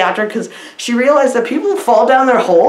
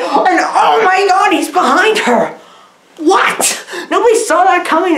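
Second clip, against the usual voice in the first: mock shocked; 8 semitones higher than usual. Real, not acted.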